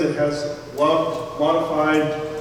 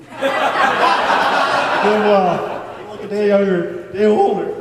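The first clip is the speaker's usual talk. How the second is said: laughing; 3 semitones higher, 6 dB louder, much slower than usual. Real, not acted. Host laughing at guest's age joke.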